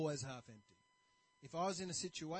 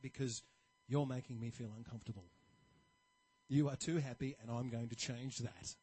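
negative guy; 7 semitones lower, 4 dB louder than usual. Retold or imitated. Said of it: "really negative sort of outlook".

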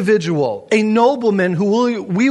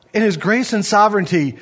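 normal talking voice

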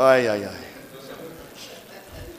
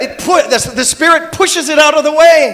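rising fervent sermon voice; preacher's voice; 3 semitones higher than usual. Real, not acted. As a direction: preach it with building fire. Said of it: "now I have a preacher's voice".

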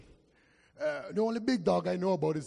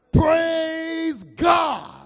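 louder, higher cry of praise; crying shout of praise; 10 semitones higher, 12 dB louder than usual. Real, not acted. Preacher overcome while reading, crying out praise. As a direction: break out in praise.

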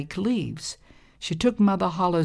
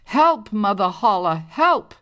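calling for help, higher and louder; a narrator's mock yell for help; mild scream; 5 semitones higher, 5 dB louder than usual. A narrator's reading. Girl in the lake screams to Mother Holla, mock yell.

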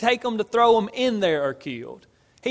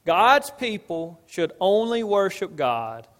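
ordinary talk

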